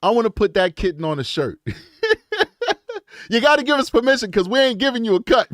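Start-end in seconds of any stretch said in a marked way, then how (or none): 3.3-5.5 higher, louder and fast through laughter; laughing. Real, not acted. To the kitten owner about shirt permission, laughing. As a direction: joke it out while cracking up.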